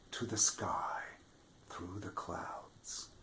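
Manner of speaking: soft, slow whisper; whispered wonder. A narrator's reading. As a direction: whisper it up into the clouds. Beanstalk reaching the sky.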